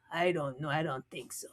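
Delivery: hushed whisper; whispered aside. Retold or imitated. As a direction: drop it under the breath. Aside under breath.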